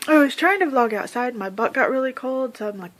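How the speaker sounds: usual speaking voice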